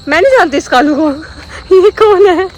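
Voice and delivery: high, loud mock-innocent denial; pretending innocence. Owner mimics denying her dog in front of auntie, high and loud. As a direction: act as if the dog is a stranger. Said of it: "I was like".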